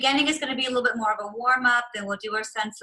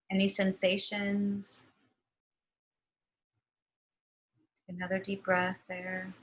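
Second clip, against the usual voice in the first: guided meditation; 4 semitones lower, 5 dB quieter than usual. Acted. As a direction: ease them gently out of rest. Closing relaxation, eyes closed.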